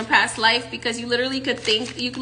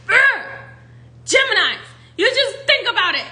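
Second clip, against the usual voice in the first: exasperated whine; 10 semitones higher, 3 dB louder than usual. Acted. Acted envy of Geminis.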